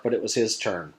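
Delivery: ordinary delivery, nothing marked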